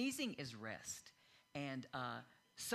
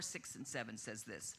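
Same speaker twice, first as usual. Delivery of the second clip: usual speaking voice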